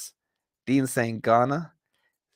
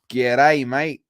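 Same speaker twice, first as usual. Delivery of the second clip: hammed-up, badly faked Australian accent; cheesy mock-Australian accent; 2 semitones higher, 6 dB louder than usual. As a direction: put on a terrible Aussie greeting. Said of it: "That's the worst Australian accent ever".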